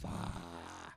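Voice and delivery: ordinary talk, nothing marked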